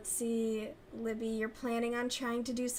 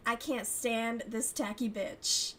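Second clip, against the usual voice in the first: ordinary talk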